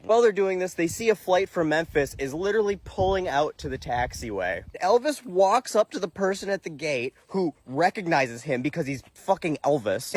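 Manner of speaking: ordinary talk, nothing marked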